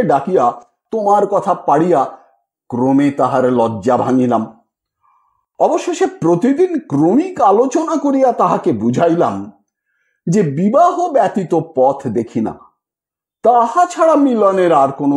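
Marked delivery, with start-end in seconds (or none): none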